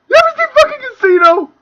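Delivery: ranting question; heated challenge. Real, not acted.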